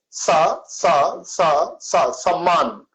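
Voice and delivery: सा and सम्मान with the tongue against the teeth; dental sa. Shown as a demonstration. Teacher repeating the dental सा for students. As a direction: hiss सा with the tongue tip on the teeth. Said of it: "टंग को अपने teeth से touch किया".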